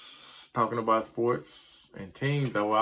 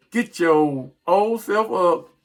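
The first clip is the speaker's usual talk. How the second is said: calling out Jerry; 7 semitones higher, 7 dB louder, slower than usual. Real, not acted. Ranting at team owner Jerry.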